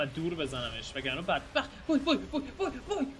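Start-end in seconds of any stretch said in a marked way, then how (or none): 1.9-3.1 high wails through laughter; laughing wails. Real, not acted. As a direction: laugh out the wai wai. Laughing at being hit.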